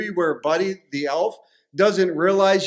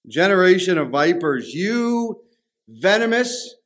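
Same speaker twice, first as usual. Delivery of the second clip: Jesus; 3 semitones higher, slower than usual. Acted. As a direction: denounce them slowly, word by word, climbing in pitch. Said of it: "Jesus says back in ... to these Pharisees".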